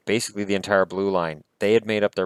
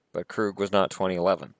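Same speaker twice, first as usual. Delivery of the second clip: ordinary delivery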